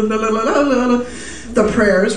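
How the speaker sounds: normal talking voice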